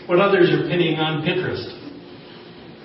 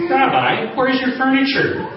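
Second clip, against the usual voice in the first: astonished; 10 semitones higher than usual. Retold or imitated. Tourist asks rabbi, astonished.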